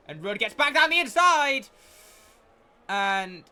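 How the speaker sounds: high, loud race call; excited commentary. Real, not acted. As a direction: burst out at the overtake. Wheel-to-wheel battle live.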